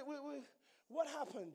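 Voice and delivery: ordinary talk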